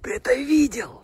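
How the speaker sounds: high, breathless whisper; excited whisper. Real, not acted. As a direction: whisper in awe at the horses.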